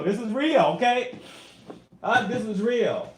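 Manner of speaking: almost shouting it at the viewers; emphatic shout. Real, not acted. Insisting to viewers it's real.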